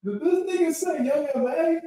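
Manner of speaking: very high, hushed squeal of disbelief; holding back laughter. Real, not acted. Streamer repeating a chat comment in disbelief, holding back laughter. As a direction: squeak it out softly while fighting laughter. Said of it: "I'm trying not to laugh".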